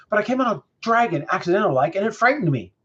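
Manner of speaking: high, quick excuse from the dog; frightened dog. A narrator's reading. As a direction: blurt it out as a scared dog.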